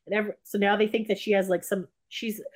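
ordinary talk, nothing marked

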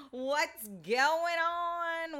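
long drawn-out, high, loud greeting; calling out. Real, not acted. Host greeting listeners on hump day, calling out. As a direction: holler it high and stretch it right out.

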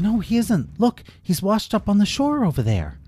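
put-on eager little girl pointing it out; girl reassuring her friend; Lila reassuring. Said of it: "And Lila said".

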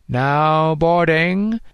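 drawn out like a terminal loudspeaker; amplified announcer. A narrator's reading. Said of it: "the amplified voice of the terminal announcer".

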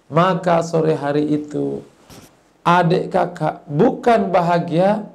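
normal talking voice

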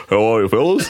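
put-on referee greeting; [name]. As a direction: greet the room in a mock referee voice. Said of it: "I got up and went".

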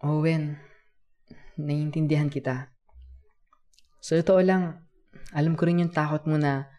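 earnest, projected encouragement; comforting elder. A narrator's reading. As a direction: console a child as his kuya, pausing between thoughts. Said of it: "ginawa ko ang makakaya ko bilang isang nakatatanda".

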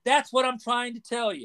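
high, mock-weary comeback; exasperated clerk. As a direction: clerk throwing it back at her. Clerk's reply in joke.

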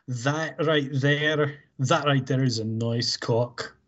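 put-on slow, drawn-out Scottish accent. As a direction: savour each word in a Scottish accent. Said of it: "that was Scottish".

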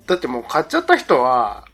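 ordinary talk, nothing marked

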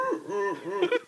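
imitated howler monkey hooting; mimicked monkey howl; howler monkey call. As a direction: make the noise of a howler monkey. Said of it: "That's the sound that they make".

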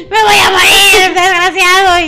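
laughing, hammed-up wail of heartbreak; mock teen despair. Friend mocking her teen self, crying out through laughs.

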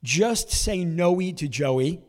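slow mock slogan. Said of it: "the headline reads".

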